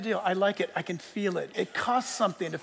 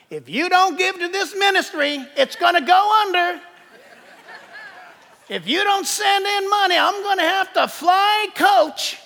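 high, loud southern televangelist plea; milk it like a money-begging preacher with a southern accent; mock southern accent; 10 semitones higher, 7 dB louder than usual. Televangelist begging the congregation for money, high, loud southern accent. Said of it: "they have to have a southern accent".

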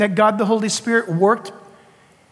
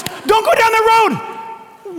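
drawn-out, much higher warning voice; mock warning; 12 semitones higher, much slower than usual. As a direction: slowly caution someone away from the road. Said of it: "If somebody goes".

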